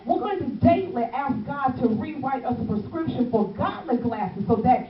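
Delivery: loud, driving sermon cadence; full-force preaching. Acted. Evangelist stirring her church to flip the script, shouting. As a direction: preach it hard to the pews.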